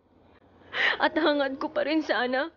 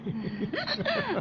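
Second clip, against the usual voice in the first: a short flirtatious giggle; coy giggle; 6 semitones higher, 5 dB quieter than usual. Acted. Wife giggling coyly at husband's compliment, flirtatious giggle. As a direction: giggle sweetly at his compliment.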